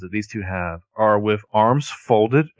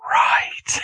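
dry whispered "right". Retold or imitated. Melissa's curt reply to Ren.

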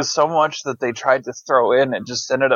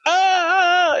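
sudden high yelp imitating a shock sting; a quick shrieked "Ah" aping horror music; mock jump scare shriek. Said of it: "in your face the whole movie".

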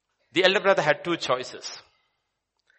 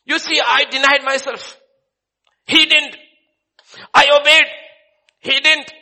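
much higher and louder, bitter outcry; shouting resentment; 12 semitones higher, 12 dB louder than usual. Acted. Elder son yells at father about his brother, shouting resentment. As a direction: spit out the comparison with your brother.

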